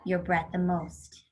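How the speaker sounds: ordinary delivery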